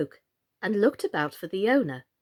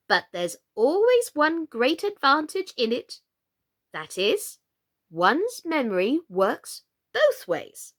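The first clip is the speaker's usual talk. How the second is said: higher, louder queen voice; 6 semitones higher, 5 dB louder, slower than usual. A narrator's reading. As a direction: cheerfully explain the oddity like a dotty queen.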